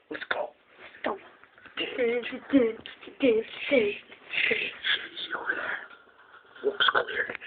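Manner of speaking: hushed sneaking whisper. Pretend. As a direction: whisper it like you're stalking prey. Sneaking up on the dog.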